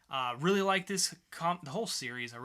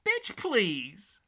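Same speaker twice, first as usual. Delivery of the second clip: higher, louder, full of sass; sassy retort; 5 semitones higher, 8 dB louder than usual. Acted. Host joking about the comic's title, loud sassy retort. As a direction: toss it off with attitude.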